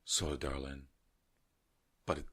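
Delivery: low, soft mock letdown with long pauses; imitated judge's rejection. Judge turning down a girl, imitated soft low voice. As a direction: drop it low and quiet, pausing before the blow. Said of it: "He's like".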